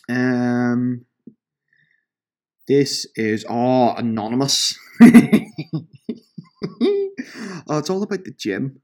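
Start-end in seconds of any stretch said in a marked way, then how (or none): 5.0-7.7 high, loud fit of giggles; gleeful giggle. Real, not acted. Host finding an anonymous gym review, high gleeful laughing. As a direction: burst into high, delighted giggling.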